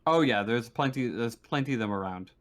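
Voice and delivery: usual speaking voice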